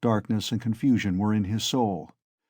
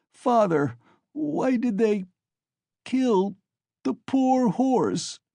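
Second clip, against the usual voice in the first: put-on very high, slow, breaking child's cry; sobbing; 12 semitones higher, slower than usual. A narrator's reading. Boy asking his father why they killed the horse, very high sobbing. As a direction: sob it out, voice cracking. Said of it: "he sobbed".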